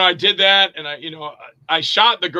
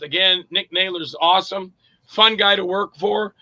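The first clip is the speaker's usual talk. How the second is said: usual speaking voice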